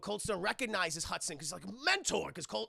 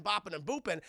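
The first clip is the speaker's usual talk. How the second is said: ordinary delivery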